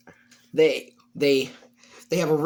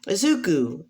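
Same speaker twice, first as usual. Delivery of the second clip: high-pitched surprised greeting; high character voice on the phone; Nezu; 6 semitones higher, slower than usual. Acted. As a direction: pitch leaps up on recognising the caller. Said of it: "Nezu says".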